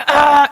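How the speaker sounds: short, loud mock yell; yelling parent. Parent losing it at the child, mock yell. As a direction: burst out with an imitated yell. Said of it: "소리 지르고 막".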